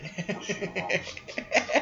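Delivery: high, voiceless giggling; laughter. Real, not acted. Amused by what's on screen.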